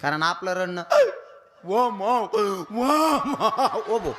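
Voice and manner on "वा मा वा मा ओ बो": drawn-out high lament wail; mock wailing. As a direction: wail it out slowly like a mourner. (ओढून लांबवलेला रडका सूर)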